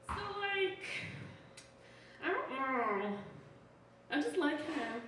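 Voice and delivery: high, hesitant lovestruck caricature; mock young fiancée. Imitating a young engaged person.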